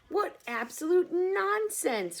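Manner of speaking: loud, high, slow scoff; cried out. Acted. A bug cries out at grasshopper, loud and scornful. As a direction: scoff loudly at the idea.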